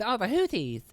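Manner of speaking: high, hooty swoop on Houthis; playful high mockery of the name; mocking name-call. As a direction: slip back into the silly high voice. Returns to silly Houthi voice.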